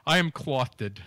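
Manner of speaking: "clothed" said as CLOTH-id; two-syllable "clothed". Acted. Co-host answering that he's dressed, "clothed" as cloth-ed.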